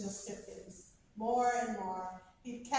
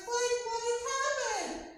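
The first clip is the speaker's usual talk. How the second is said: shocked question; 13 semitones higher, faster than usual. Retold or imitated. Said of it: "And I was like".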